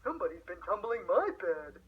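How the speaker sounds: lightly voiced middle-sized bear; a hint of a mother bear, a touch put out; gently voiced middle bear. A narrator's reading. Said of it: "the middle-sized bear said".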